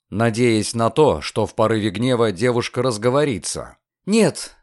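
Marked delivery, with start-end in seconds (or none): 4.1-4.6 high, abrupt refusal; curt girl's voice; sharp refusal. A narrator's reading. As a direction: cut them off sharply. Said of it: "резко отрезала Рода".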